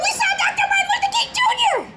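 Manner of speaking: put-on high, fast, laughing girl's gush; girl explains to Dr King, giddy and fast; excited child. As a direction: tumble it all out breathlessly with glee. Child tells principal, thrilled.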